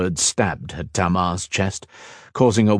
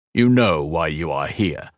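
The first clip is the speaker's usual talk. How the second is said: booming baritone; 3 semitones lower than usual. A narrator's reading. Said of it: "His deep baritone voice boomed".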